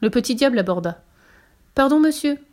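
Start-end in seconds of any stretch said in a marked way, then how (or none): 1.8-2.4 high, louder polite hail; small devil's raised voice; little devil calling out. A narrator's reading. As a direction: stop a passer-by politely. Devil calls out to passing priest.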